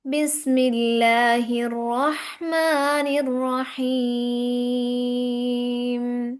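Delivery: slow melodic tajweed recitation; Quran recitation. Shown as a demonstration. Teacher reciting verses to her students, slow melodic tajweed. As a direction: chant each verse slowly with tajweed.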